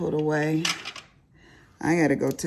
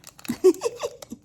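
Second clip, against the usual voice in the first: high laugh; 4 dB louder than usual. Real, not acted. Laughing after her own joke.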